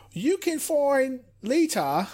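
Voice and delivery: put-on a clumsy half-hearted try at Lita's accent; bad imitation of Lita's accent. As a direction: fumble through a bad copy of Lita's accent. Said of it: "That's such a bad accent".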